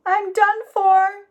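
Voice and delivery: high, slow, scared gasp of a thought; terrified thought. A narrator's reading. Jack facing the grizzly, frightened. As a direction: breathe it out in terror. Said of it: "Jack thought".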